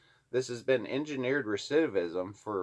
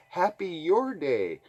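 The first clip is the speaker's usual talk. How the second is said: mock greeting; 7 semitones higher, 3 dB louder than usual. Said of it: "It's like".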